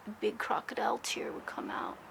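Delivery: soft unvoiced whisper; hushed, emotional whisper. Real, not acted. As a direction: breathe it out on a whisper. Tender memory of dying mother.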